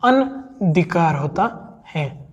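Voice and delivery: अनधिकार said slowly, short na; slow correct form. Shown as a demonstration. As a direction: drag out अनधिकार for dictation. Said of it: "ना नहीं आएगा".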